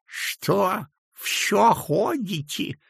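put-on high, slow toothless old man; mumbling old man. A narrator's reading. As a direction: mumble it with gummy mouth. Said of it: "зашамкал он".

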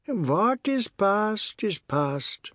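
high, very slow matriarch voice; Missus Tulsi's soothing plea. A narrator's reading. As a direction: draw each word out high and slow, placating.

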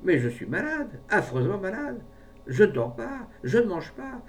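high-pitched plaintive complaining; piteous. A narrator's reading. Brave man insists to the pharmacist he is ill, piteously. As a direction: plead sickness, each complaint pitched high. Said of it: "interjeta piteusement le brave homme".

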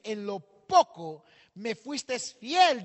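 "En lo poco me fuiste fiel" muy lento y fuerte, con énfasis en "fiel"; cita bíblica lenta. Shown as a demonstration. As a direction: recitar la cita palabra por palabra, marcando "fiel". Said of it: "La Biblia dice". (pastor que cita la Biblia, lento y solemne)

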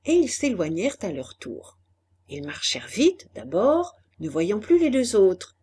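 plain reading voice

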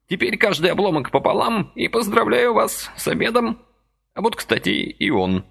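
put-on high, chirpy old man's voice; lively old man pressing a deal; brisk old man. A narrator's reading. Lively old man persuading Vasily, high chirpy voice.

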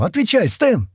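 high-pitched, urgent order; insistent demand. A narrator's reading. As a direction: demand an answer, pitch leaping up. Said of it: "потребовала она". (требовательный выкрик)

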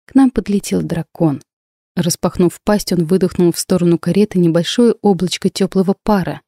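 ordinary delivery

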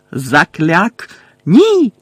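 shouted spell. Acted. Spell cast and cry out.

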